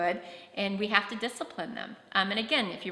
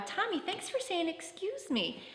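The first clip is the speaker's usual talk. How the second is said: much higher, soft, slow praise; sweet praise voice; 10 semitones higher, 4 dB quieter, slower than usual. Shown as a demonstration. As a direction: beam at the child as you thank him.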